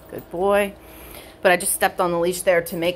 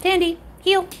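much higher, bright call of the command; dog command voice; 13 semitones higher, 4 dB louder than usual. Real, not acted. Trainer calling her dog Tandy to heel, high call. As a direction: sing out the dog's name then the command.

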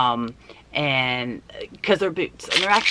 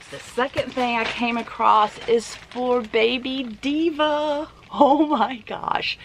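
much higher, gushing delight; excited squeal; 10 semitones higher than usual. Real, not acted. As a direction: squeal over the cute boots. Excited reveal of baby boots.

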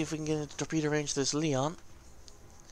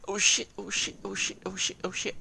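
higher, repeated panicked cursing; mock panic; 5 semitones higher than usual. Player reliving the moment he noticed Dust coming, comic panic. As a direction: rattle it off in comic alarm. Acts out noticing incoming threat.

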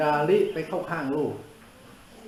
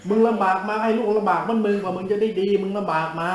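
higher, louder scolding rant; berating; 5 semitones higher, 7 dB louder than usual. Real, not acted. Monk lecturing foolish parents, loud scolding. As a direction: hammer the point at the parents.

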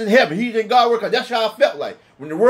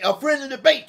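usual speaking voice

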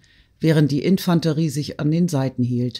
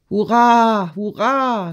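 high, louder hurrah falling away; cheering shout; 6 semitones higher, 3 dB louder, slower than usual. A narrator's reading. A man cheering at the fire, loud shout. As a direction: cheer it out with a raised arm. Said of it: "schrie ein Mann".

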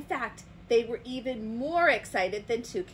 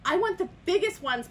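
panting; 9 semitones higher, 6 dB louder than usual. Acted. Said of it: "panted Toucan".

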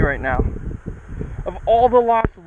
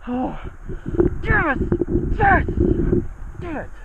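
triumphant yes; 12 semitones higher than usual. Real, not acted. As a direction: shout it out in triumph. Fish released, triumph.

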